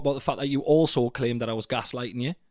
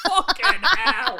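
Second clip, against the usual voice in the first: high, slow laugh-choked curse; laughing disbelief; much slower than usual. Real, not acted.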